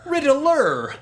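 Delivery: high, exclaimed reveal; dramatic Riddler reveal. Retold or imitated. Quoting the clip's big reveal, a high exclamation. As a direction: announce the villain with a flourish. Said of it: "quoting the clip".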